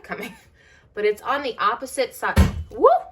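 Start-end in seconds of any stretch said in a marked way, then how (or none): 2.7-3.0 sudden high, loud yelp of fright; startled yelp. Real, not acted. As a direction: yelp as the stool wobbles. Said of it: "scare the beans out of me".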